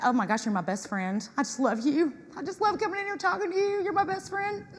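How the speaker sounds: mock sugary flattery, softly; gushing best friend. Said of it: "someone come and say".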